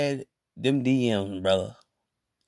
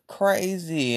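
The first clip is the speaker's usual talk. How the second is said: normal talking voice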